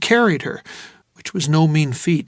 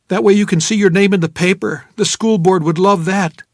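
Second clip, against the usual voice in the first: a touch of a stern threat; 4 semitones higher, 5 dB louder than usual. Acted. Threatening to call ambulance.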